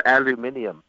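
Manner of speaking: mock-British "aluminium", al-yoo-MIN-ee-um; British-style "aluminium" with the extra syllable; English pronunciation. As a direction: say "aluminium" in an imitated English accent. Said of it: "English pronunciation".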